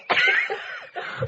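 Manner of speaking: very high, breathy squeal of laughter; real giggle fit. Real, not acted. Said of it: "twenty minutes of laughter".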